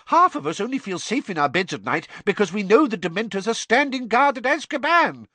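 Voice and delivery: high, fast and indignant; outraged minister. A narrator's reading. Fudge protesting about the Dementors, high and fast. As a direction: rush the protest.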